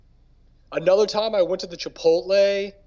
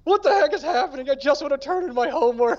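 mock bewildered guy; 9 semitones higher than usual. Bewildered student quoted.

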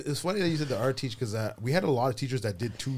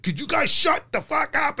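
angry teacher; 7 dB louder, slower than usual. Retold or imitated. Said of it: "he was just like, he's like".

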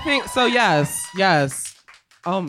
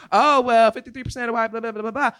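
mocking hot takes; 7 semitones higher, 3 dB louder than usual. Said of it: "And everybody's like".

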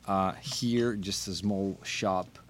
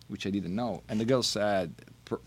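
ordinary talk, nothing marked

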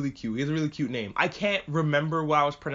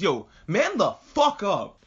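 slow, punched-out mock outburst, pitched up; snapping at him; 4 semitones higher, 3 dB louder, slower than usual. Kid telling the panicking guy off, snapping. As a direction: snap it out word by word. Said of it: "one kid just snaps on him".

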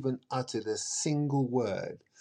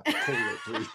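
laughing. Real, not acted. Laughing plea at his joke.

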